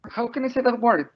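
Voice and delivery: high-pitched imitation of a little girl asking; a hint of a baffled toddler; lightly voiced puzzled child. Mother voices daughter asking about the word, mock puzzled.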